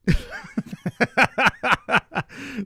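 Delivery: a high, helpless cackle that breaks out; burst of high laughter. Real, not acted. Streamer laughing at Boston accents, high cackle.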